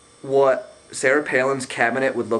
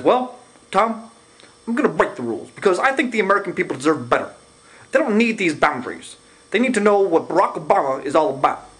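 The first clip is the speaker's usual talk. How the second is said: much higher, excited mock Obama; imitating Obama; 6 semitones higher, faster than usual. Mocking Obama answering the moderator, much higher and excited. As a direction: ham up a candidate breaking the rules. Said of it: "He says".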